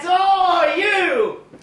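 put-on high drunken hail; calling out. Drunk man hailing a rickshaw, calling out high. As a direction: hail him across the street.

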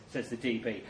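usual speaking voice

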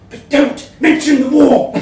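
high, loud comic outburst; mock shout. Letter writer teasing the magazine, loud comic shout. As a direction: blurt it out as a cheeky punchline. Joke about Germans in letter.

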